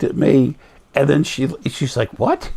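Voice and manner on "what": stunned nurse. Retold or imitated.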